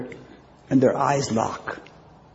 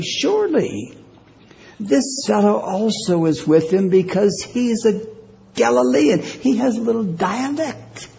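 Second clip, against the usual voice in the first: much higher, rising accusation; confident accuser; 10 semitones higher than usual. Acted. Bystander confidently accusing Peter, rising to Galilean. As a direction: nail him with it, building.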